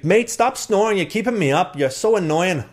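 high, whiny mock Irish complaint; Irish accent. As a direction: imitate an annoyed Irish roommate. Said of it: "work on your Irish accent".

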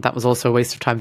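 ordinary talk

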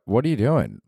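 usual speaking voice

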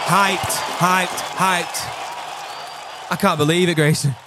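high, hyped chant; excited. Real, not acted. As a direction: repeat it like a fan. Repeating hype after announcement.

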